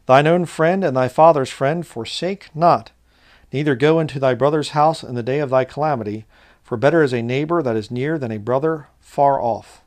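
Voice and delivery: loud, measured reading of a proverb; solemn preacher's scripture reading. Acted. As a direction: proclaim the verse to the congregation. Reads scripture aloud.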